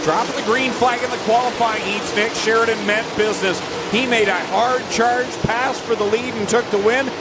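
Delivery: lively live race play-by-play; motorsport commentator. Real, not acted. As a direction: call the lead battle with rising energy.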